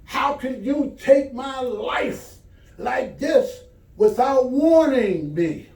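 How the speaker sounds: high, slow, shouted protest to God; furious man wailing at God; angry outcry. Acted. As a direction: cry out in outrage, dragging the words. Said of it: "he was angry".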